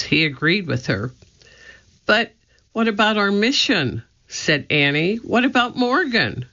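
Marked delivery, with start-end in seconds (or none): 2.0-4.0 high, pleading objection; high-pitched, concerned appeal; worried protest. A narrator's reading. Annie objects to going home. 5.2-6.4 high, pleading question; high-pitched, concerned objection; worried protest. A narrator's reading. Annie objects to going home.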